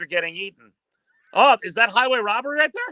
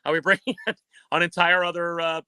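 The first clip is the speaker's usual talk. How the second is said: lower, unhurried wildlife-film narrator voice; nature documentary narration; 5 semitones lower than usual. Acted. Calling a lizard versus kangaroo clip for the host, lower documentary narration.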